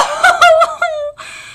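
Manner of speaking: high, loud laugh cracking into a sob; laugh-cry. Real, not acted. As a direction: laugh and cry at once, for real. Laugh breaking into crying.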